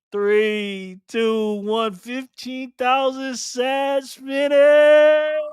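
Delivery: slow, rising game-show countdown; countdown climax. Real, not acted.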